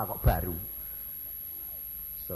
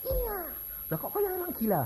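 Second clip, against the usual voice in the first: mock outsider; 11 semitones higher than usual. Voicing mocking outsider.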